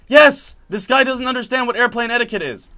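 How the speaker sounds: high, loud exasperated tattling; indignant complaint. Retold or imitated. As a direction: report the offender to the attendant, fed up. Acted complaint to the flight attendant.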